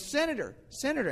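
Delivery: high, falling hail to a passerby; calling out. Retold or imitated. Stranger hails the preacher as senator on the street, calling out high.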